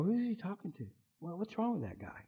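imitating puzzled onlookers under their breath; bystanders' mutter. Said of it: "people look at me and go".

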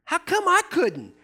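high, whiny complaint; mock jealous whine; jealous disciples. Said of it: "they're like".

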